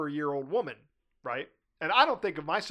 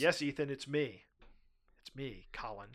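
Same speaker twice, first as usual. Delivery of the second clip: mock reveal; 2 semitones lower, 6 dB quieter than usual. Uncle to his nephews, a soft mock reveal. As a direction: play the secret identity with a hushed drop.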